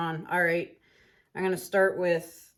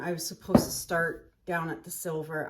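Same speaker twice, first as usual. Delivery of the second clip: usual speaking voice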